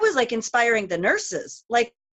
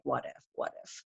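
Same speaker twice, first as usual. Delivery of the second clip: whisper; 6 semitones lower, 12 dB quieter than usual. Retold or imitated. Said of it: "think about".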